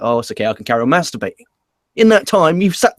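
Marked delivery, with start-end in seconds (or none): 0.0-1.4 fast, lower throwaway line; mock casual shrug. Podcaster mocks a viewer at home, fast and casual. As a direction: rattle it off like a bored viewer. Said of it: "you're like".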